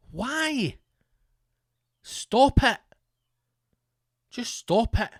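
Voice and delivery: high and loud, begging them to stop; exasperated pleading. Acted. Telling pasty Scottish men to stop playing basketball, exasperated plea. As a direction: cry out at them to quit, fed up.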